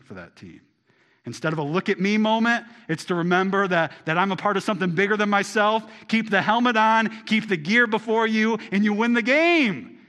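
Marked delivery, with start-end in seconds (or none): 1.2-10.0 higher, louder preaching push; full-force preaching. Acted. Preacher urging the congregation to wear compassion, at full force. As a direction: drive it home to the pews. Preacher climaxes football lesson.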